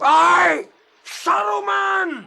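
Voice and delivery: loud, slow cry of the foe's name; battle shout. Acted. Ranger shouting the wizard's name as he attacks. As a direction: hurl the name like a blow.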